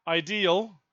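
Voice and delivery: ordinary talk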